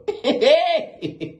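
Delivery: high laugh; burst of laughter. Real, not acted. Teller cracking up at the story, laughing.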